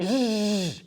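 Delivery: Z buzzed loud with strong vibration; voiced z demo, buzz held; vibrating Z. Shown as a demonstration. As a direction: let the Z buzz so they feel it. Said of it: "feel the vibration".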